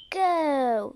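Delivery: ordinary talk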